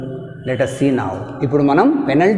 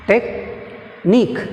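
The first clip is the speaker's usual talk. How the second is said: technique in two slow, higher parts, stressing NIQUE; stress on last syllable; 5 semitones higher, 3 dB quieter, much slower than usual. Shown as a demonstration. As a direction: break technique before a drawn-out nique. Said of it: "stress on".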